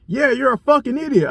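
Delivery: high, sneering insult; mocking the other driver. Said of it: "He was like".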